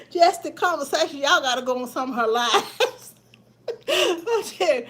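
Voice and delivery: high, real laughter breaking into the words; laughing recommendation of a funny friend; cracking up. Real, not acted. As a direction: talk while tickled, pitch jumping up. Tickled remembering friend's funny lives.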